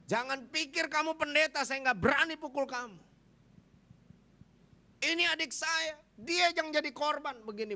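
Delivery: high, loud imitation of furious shouting; retold angry threat. Twin brother threatens the pastor, acted angry shouting. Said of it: "Kenapa kamu harus marah-marah".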